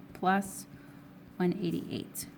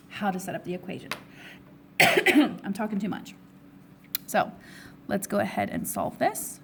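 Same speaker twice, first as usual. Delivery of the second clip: hoarse, strained voice with coughs; losing voice. Real, not acted. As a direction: voice giving out, hoarse. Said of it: "I'm losing my voice".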